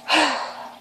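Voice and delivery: high, airy smitten sigh; swooning sigh. Real, not acted. Fan recalls her sweet idol, high swooning sigh. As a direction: sigh as if your heart just melted. Heart melting over Ricky.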